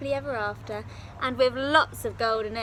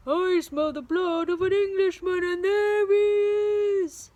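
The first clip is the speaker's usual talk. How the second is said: roaring giant; 8 semitones higher, 8 dB louder than usual. Acted. Giant roars chasing Jack.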